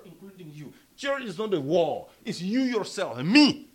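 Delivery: ordinary talk, nothing marked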